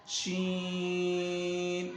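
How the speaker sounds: شین with very long held "ee"; long madd. Shown as a demonstration. Teacher models five-count stretch, شین held very long. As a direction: chant شین on one steady note. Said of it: "پانچ الف کھینچ کر پڑھنا ہے".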